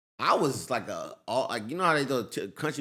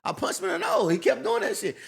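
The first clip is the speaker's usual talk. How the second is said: excited; 12 semitones higher than usual. Real, not acted. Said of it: "Wait, wait, who punched him?".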